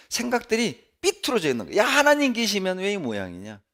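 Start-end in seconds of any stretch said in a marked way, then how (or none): 1.8-3.6 higher, jeering taunt; mocking taunt. Preacher voicing scoffers jeering at a believer, mocking. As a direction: needle him with a sneer. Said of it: "이 삐뚤어지는 거예요".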